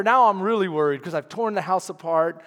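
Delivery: usual speaking voice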